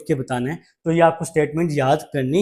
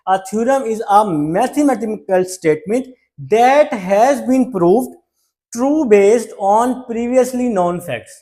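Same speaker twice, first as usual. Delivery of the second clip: slow, spaced and higher, as if read from the book; slow dictation read; 4 semitones higher, much slower than usual. Shown as a demonstration. Teacher reads the theorem definition aloud for copying, slow and spaced. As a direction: dictate it word by word.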